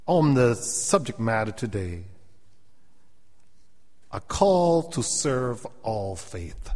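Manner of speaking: slow, deliberate pulpit delivery; measured preacher's cadence; slow sermon opening. Real, not acted.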